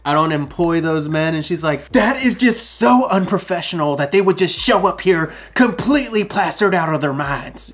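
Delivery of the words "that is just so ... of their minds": put-on country accent. Angry mom complaining about drunk workers, light country accent. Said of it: "They didn't have a country accent, but that's how I remember it".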